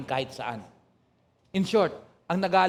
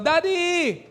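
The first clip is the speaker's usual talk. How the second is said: put-on child calling out; 11 semitones higher, 3 dB louder than usual. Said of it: "O kaya sisigaw".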